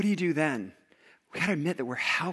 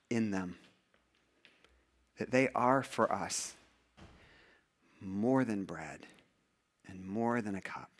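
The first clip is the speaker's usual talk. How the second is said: hushed communion prayer; 4 semitones lower than usual. Acted. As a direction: pray humbly over the bread and cup.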